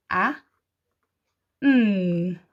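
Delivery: "ah", a pause, then hummed "mm"; "ah, mm" slowly, sound by sound. Shown as a demonstration. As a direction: sound out "ah, mm" as two separate sounds. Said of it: "We're gonna slow-mo it".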